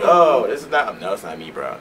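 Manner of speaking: sudden high, loud surprised cry; surprised girls. Retold or imitated. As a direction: jump up in mock shock. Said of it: "and they get surprised".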